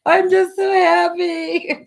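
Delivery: high, slow, teary laugh-cry; laughing through tears. Retold or imitated. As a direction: wail happily while weeping. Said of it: "I'm like".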